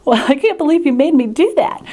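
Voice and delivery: high, laughing protest; laughing disbelief. Retold or imitated. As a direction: laugh it out in mock outrage. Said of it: "And I said".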